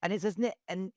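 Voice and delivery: ordinary talk, nothing marked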